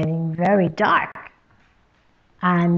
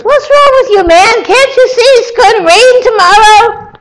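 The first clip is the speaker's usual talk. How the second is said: loud, much higher, exasperated wife; exasperated quarrel; 12 dB louder, faster than usual. Acted. First woman snapping back at her stubborn husband, loud and exasperated. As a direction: throw it back at him, fed up.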